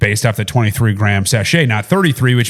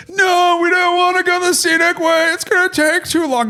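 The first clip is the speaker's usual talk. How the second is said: put-on much higher, shouted childish whine; whining kids. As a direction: kids protesting the scenic route. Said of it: "the kids are like".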